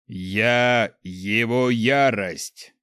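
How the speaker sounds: slow, thunderous divine proclamation; booming voice. A narrator's reading. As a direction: boom each word out slowly like a herald of God. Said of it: "громогласно произнёс он".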